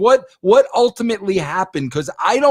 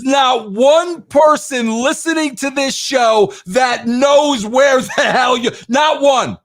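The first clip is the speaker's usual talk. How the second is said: raised, slower, insisting nobody can follow; exasperated shout; 5 semitones higher than usual. Real, not acted. Host exasperated at the rambling guest, shouting. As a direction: shout the point over him, stretching it out.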